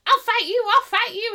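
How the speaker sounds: high, loud mock challenge; hammed-up kid itching to fight; kid picking a fight. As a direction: taunt like a scrappy little kid. Acting the kid's fake challenge.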